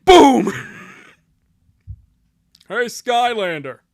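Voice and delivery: drawn-out, loud hail in a poor impression; big, slow impression, not quite landing; Patrick Warburton. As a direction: call out slowly in a badly faked celebrity voice. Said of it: "my Patrick Warburton impression just isn't good".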